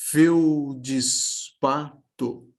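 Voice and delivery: Feldispato slow, fel-di-spa-to, each beat apart; syllable by syllable. Shown as a demonstration. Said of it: "A gente acaba acrescentando um i aqui na fala".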